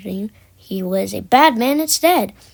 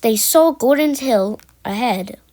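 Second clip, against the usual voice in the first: plain reading voice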